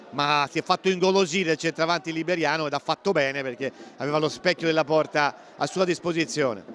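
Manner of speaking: normal talking voice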